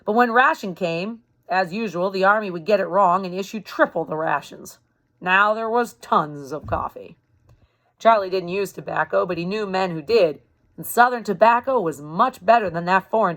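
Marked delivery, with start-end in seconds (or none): none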